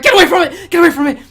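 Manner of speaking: high, loud frantic shouting; panicked scream. Acted. Survivor spots the paper under the door, screaming in panic. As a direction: scream at them to get back. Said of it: "you hear a scream".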